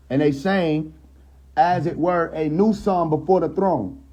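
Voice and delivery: soaring pulpit delivery; preached reading. Shown as a demonstration. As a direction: proclaim it like a revival preacher. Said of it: "I said it like that on purpose".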